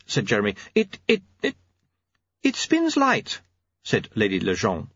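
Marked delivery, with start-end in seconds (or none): 0.8-3.4 high, halting stutter; stammering in a high character voice; hesitant stammer. A narrator's reading. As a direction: get stuck on the first word, pitched high. Jeremy stammers explaining his glowing device.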